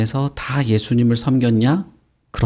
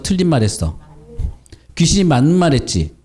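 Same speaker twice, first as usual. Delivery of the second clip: usual speaking voice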